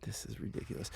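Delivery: ordinary delivery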